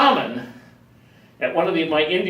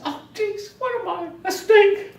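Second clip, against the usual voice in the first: high, squeaky mock alarm; mock dismay; 3 dB louder than usual. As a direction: play up the shock of being called a snake. Retold dismay at being a snake.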